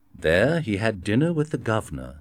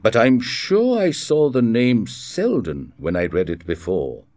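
confused; 2 semitones higher, 5 dB louder than usual. A narrator's reading.

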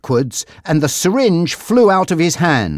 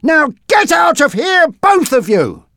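shout; 13 semitones higher, 3 dB louder than usual. Acted. Bob threatening with a spanner.

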